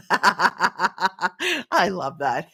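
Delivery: laughing, slow words through giggles. Real, not acted. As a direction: say it while cracking up. Laughing at viewer joke.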